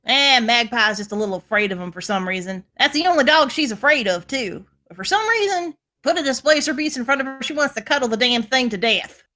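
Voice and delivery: Southern twang in place of a Scottish one; Southern accent. Acted. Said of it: "slipped into the, the southern accent".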